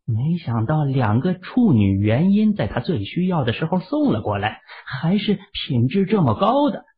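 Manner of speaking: usual speaking voice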